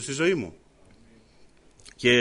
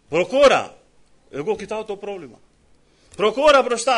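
louder, higher command; urging call; 5 semitones higher, 5 dB louder than usual. Acted. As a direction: push them on with a loud call. God urging the believer forward. (ο Θεός σπρώχνει τον πιστό, δυνατή προτροπή)

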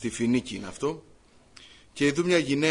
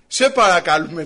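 loud, emphatic mock plea; acted pleading; 2 semitones higher, 9 dB louder than usual. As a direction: imitate disciples begging out loud. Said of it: "Παρεκαλούν οι μαθητές τον Κύριο".

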